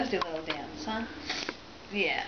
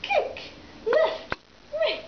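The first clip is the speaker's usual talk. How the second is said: excited sing-song cheering; 8 semitones higher, much slower than usual. Real, not acted. Cheering baby's exercise.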